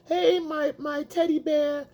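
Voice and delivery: high, mock-cutesy female voice; mimicked girlfriend. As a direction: coo a pet name in an imitated girlfriend voice. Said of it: "she's like".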